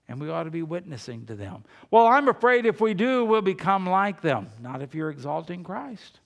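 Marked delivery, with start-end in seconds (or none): 1.9-4.5 louder, higher objection; imagined objector. Acted. Preacher voices a churchgoer afraid of becoming like them, loud objection. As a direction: blurt it out as a worried churchgoer's protest.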